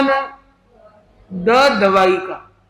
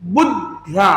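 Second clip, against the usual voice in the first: बुद्ध्या with pressure on बुद्; stress on first syllable; 3 semitones lower than usual. Shown as a demonstration. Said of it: "ऊ जो है यहाँ पे थोड़ा प्रेशर आएगा". (बुद्ध्या, बुद् पर दबाव)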